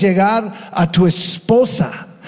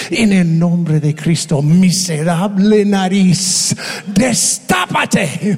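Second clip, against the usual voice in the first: angry command; slower than usual. Retold or imitated. Pastor angrily ordering a blocked nose to open. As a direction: stretch the command out in anger. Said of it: "otra vez me enojé".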